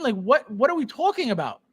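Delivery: ordinary talk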